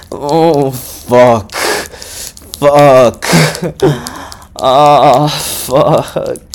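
real loud moaning; loud moans. Real, not acted. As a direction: let the words spill out as loud moans. Loud moans in intimate audio.

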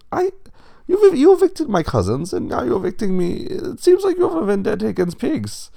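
very high, loud accusation; indignant rich pig. A narrator's reading. Lenny accuses the wolf of a vendetta, indignant.

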